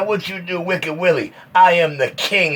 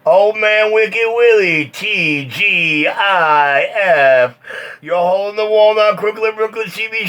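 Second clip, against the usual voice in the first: drawn-out radio callout; 4 semitones higher, slower than usual. Real, not acted. Calling out over CB radio.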